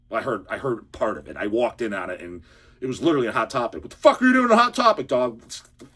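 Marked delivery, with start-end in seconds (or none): 3.8-5.4 higher, louder, fast retold outburst; incredulous outburst. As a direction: blurt it out in disbelief. Retells his outburst in the store.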